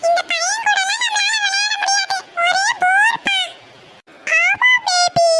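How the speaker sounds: put-on high, mildly shouted child's grumble; high kid voice. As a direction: pitch up into a bratty child complaining. Kids scorn the village cousin.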